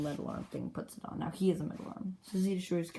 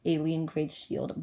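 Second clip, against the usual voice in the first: ordinary delivery, nothing marked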